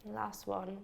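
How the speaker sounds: calm, unhurried meditation guide with long pauses; measured meditation voice, leaving space for each breath; guided meditation. Acted. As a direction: lead a beginner gently into relaxation, phrase by phrase. Leads a beginner's meditation.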